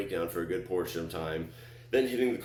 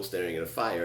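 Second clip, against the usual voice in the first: plain reading voice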